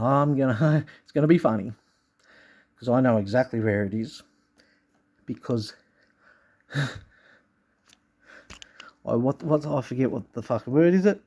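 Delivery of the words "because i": ordinary delivery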